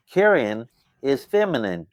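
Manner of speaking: normal talking voice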